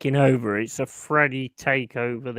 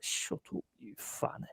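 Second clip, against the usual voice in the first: whispered, much softer insult; under-breath whisper; 3 semitones higher, 15 dB quieter than usual. Retold or imitated. Telling the mate what he muttered at the little guy, in a whisper. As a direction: hiss it under your breath. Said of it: "I was like".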